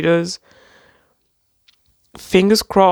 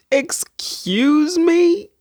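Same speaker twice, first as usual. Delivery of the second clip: slower and higher "Excuse me?", rising at the end; even bigger disbelief; 13 semitones higher, much slower than usual. Real, not acted. To viewers, topping her first gasp, stunned. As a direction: stretch it out, utterly floored. Shocked at book cover.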